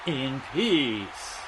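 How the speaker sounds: slow, solemn dead man voice; slow graveside chant; slow Undertaker intone. Pretend. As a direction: intone it like the Deadman at a funeral. Undertaker voice while playing.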